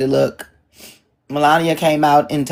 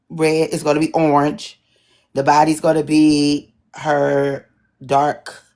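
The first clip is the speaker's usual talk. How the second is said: ordinary talk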